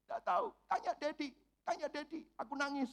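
a hint of a whiny seven-year-old; little daughter. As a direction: lightly play the sulking little girl. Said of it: "Terus ditanyain".

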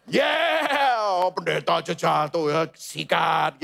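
hammed-up devil urging go for it; egging on. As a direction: cheer them into it like a reckless buddy.

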